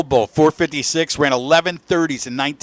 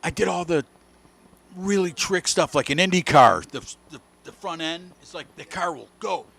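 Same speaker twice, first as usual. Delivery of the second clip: very soft, halting retold explanation; 10 dB quieter than usual. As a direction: quote him hushed and offhand. Retelling a laconic racer's words.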